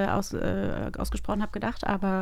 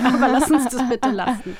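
laughing; 3 semitones higher, 8 dB louder than usual. Real, not acted. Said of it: "laugh heard".

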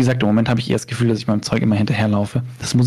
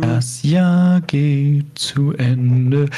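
slow and solemn; much slower than usual. Acted. Mock solemn year-end line.

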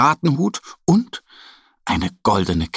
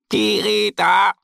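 much higher, slow squawk; parrot squawk; 11 semitones higher than usual. Acted. The parrot Pirili greets them, squawking. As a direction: screech it like a parrot. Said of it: "krächzt er".

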